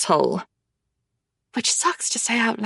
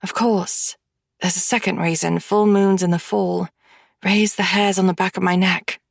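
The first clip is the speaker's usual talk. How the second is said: usual speaking voice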